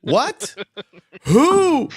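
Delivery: high, loud shocked yelps; shocked squeak. Real, not acted. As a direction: yelp it in mock outrage. Reacting to co-host's crude joke.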